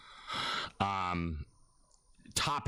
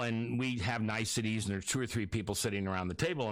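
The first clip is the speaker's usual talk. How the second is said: normal talking voice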